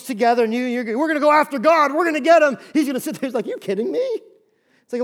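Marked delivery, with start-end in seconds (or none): 1.0-2.6 loud, high boastful war cry; mock battle cry. Preacher voicing armies shouting their threat at God. 3.5-4.0 amused scoff. Retold or imitated. God scoffing at the armies.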